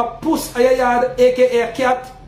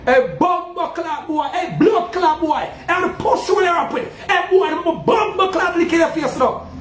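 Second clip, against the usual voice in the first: raised, laughing patois cursing; a yard man hamming up a patois threat; high mock-angry Jamaican patois curse; 5 semitones higher than usual. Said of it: "when you hear a man come and say".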